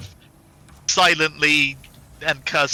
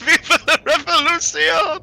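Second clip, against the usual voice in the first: shouted through laughter, higher; laughing battle cry; 13 semitones higher, 4 dB louder than usual. Pretend. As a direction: cheer it while cracking up. Repeated mock chant, laughing.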